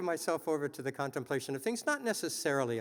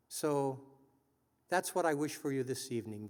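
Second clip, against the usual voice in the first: normal talking voice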